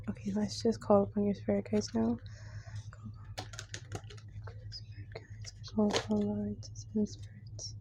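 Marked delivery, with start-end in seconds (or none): 2.9-7.7 whispering. Real, not acted. Invoking spirit guides.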